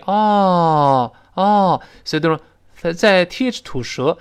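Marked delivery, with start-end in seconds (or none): 0.1-1.8 long open "啊", like ah; American open vowel. Shown as a demonstration. Teacher repeats the vowel for students, "啊" long and open. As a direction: hold "啊" open and unrounded. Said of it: "就这么一个声音".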